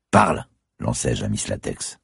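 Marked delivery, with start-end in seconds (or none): 0.1-0.5 barked order. A narrator's reading. Gunman orders woman to talk.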